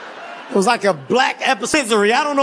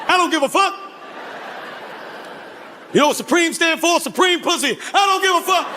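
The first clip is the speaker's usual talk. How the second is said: put-on old [name]; 4 semitones higher than usual. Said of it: "He said".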